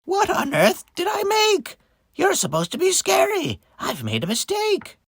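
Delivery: high, appalled witch; witch's screech. Acted. Witch regretting the pumpkin she made, screechy dismay. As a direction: complain shrilly as a dismayed witch. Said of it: "screeched the witch".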